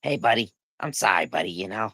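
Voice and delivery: lightly imitated sheepish apology; Henry. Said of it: "he'll call me the next day and be like".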